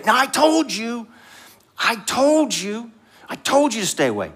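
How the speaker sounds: high-pitched I-told-you-so; hammed-up parental scolding. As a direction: scold the child, drawing out the reproach. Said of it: "instead of scold them".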